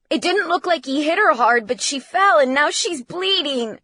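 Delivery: high, loud, agitated report; distraught son's urgent account; frantic, hurried telling. A narrator's reading. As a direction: spill it out in panic. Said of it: "He was speaking so fast".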